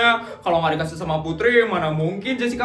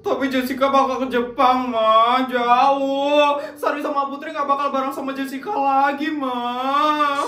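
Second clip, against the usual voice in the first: tearful; 6 semitones higher than usual. Acted. Child crying to mother.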